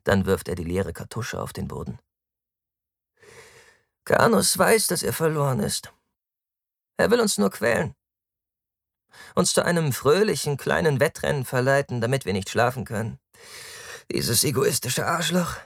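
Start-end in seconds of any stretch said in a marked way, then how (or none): 4.0-15.6 high-pitched, sarcastic character voice; high and loud at first, easing down; carefree Taktus, complaining. Acted. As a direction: voice a carefree loafer griping about his enemy.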